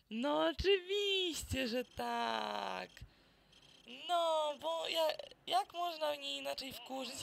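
loud, high, drawn-out groan of annoyance; exasperated exclamation. Real, not acted. Gamer fed up at getting this mission again, loud exasperation. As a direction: drag out the words, fed up with the mission.

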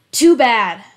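a sharp, loud dismissive retort; loud taunt. Acted. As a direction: throw it back hard at him. Attacker answers with a taunt.

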